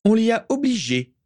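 high, projected declaration; forceful high-pitched statement; strong voice. A narrator's reading. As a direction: declare it firmly, pitched well up. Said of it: "d'une voix forte".

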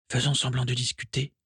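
low voice. A narrator's reading. Daisy plotting with Fergus before the door opens, hushed. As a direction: keep it down, conspiratorially. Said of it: "proposa Daisy à voix basse".

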